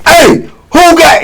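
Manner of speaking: high, toothless old inmate outburst, played for laughs; snapping in anger. Inmate demanding who took his cup, snapping angrily. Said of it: "he, he snapped".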